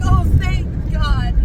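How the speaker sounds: high, slow relieved sigh of thanks; relieved owner. Retold or imitated. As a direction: let relief pour out. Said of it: "He's like".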